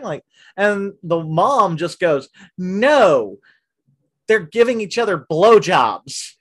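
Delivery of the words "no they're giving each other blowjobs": loud, raised-pitch retort; imitated blunt mom. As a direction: land the mom's correction emphatically. Quoting the mom's blunt punchline.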